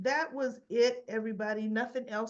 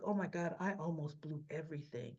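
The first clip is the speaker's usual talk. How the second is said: hushed realization; 6 semitones lower, 8 dB quieter than usual. Retold or imitated. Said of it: "she's like".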